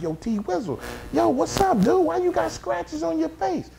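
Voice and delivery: high-pitched, rising questioning voice; retold concerned call. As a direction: call out high to a hurt friend. Retold greeting to injured man.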